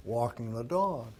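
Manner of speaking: ordinary talk, nothing marked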